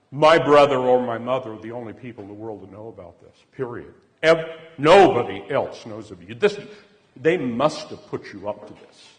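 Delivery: loud, forceful indignant accusation; acted-out outraged protest; livid insistence. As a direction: hammer it out as an angry accusation. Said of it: "She just went livid".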